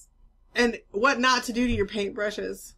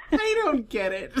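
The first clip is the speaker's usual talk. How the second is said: horrified amusement; 3 semitones higher, 3 dB quieter than usual. Real, not acted. Laughing fit.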